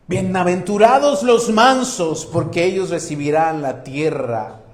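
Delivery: loud, high-pitched sermon voice; fiery preaching. Acted. Pastor preaching loudly to the congregation, fervent. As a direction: preach it out with full force. Preaching at full force again.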